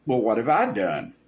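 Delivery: challenging, blameless "what have I done"; offhand mock protest of innocence; unaware self-justification. Shown as a demonstration. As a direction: ask it as if entirely innocent. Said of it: "being completely unaware of their sin".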